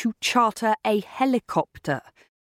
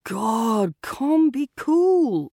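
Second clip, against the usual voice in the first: slow, high, stretched-out drawl; languid drawl; 5 semitones higher, much slower than usual. A narrator's reading. Bart sliding out of his sports car to his angry brother, drawled. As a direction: draw the words right out, lazily. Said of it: "drawled his brother Bart".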